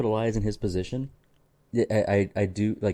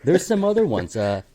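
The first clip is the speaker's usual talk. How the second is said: ordinary talk, nothing marked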